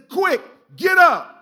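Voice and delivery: loud, slow, high urgent order; angel's loud command. Acted. Preacher voicing the angel waking Peter, loud and urgent. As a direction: wake him up sharply. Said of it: "He says".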